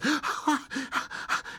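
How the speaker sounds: shaky caught breath; sharp gasp. A narrator's reading. Old man seized by horror, catching his breath. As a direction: gasp in sudden dread. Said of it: "he caught his breath".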